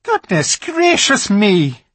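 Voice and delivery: high, loud, slow gasp of surprise; a fawn startled into crying out; startled exclamation. A narrator's reading. Fawn dropping his parcels, crying out in surprise. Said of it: "exclaimed the fawn".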